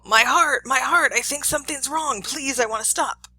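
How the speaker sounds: high, loud acted plea; pained yelling. Teacher plays shocked man yelling from next room, high pleading yell. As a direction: yell in alarm, easing into begging. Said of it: "Mister Johnson yells from the other room".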